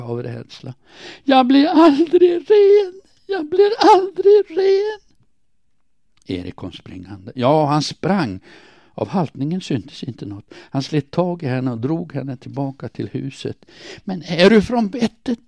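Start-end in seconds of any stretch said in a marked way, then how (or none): 1.2-5.0 high, drawn-out, full-force shriek; terrified-sounding scream. A narrator's reading. Woman scrubbing herself with water in the yard, screaming. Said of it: "samtidigt skrek hon, ett skrik som fick mig att skaka". 14.0-15.4 raised, much higher and louder voice; angry shout. A narrator's reading. Erik running to drag the screaming woman back, shouting. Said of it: "ropade han".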